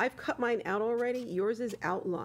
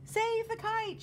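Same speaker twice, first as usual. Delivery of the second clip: shouting; 12 semitones higher, slower than usual. Acted. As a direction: yell it to save the kite. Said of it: "shouted Mole".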